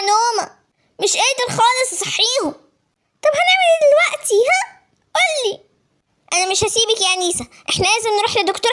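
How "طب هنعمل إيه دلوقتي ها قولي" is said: put-on higher girl's voice, worried and pressing; a scared younger sister demanding an answer; anxious little sister.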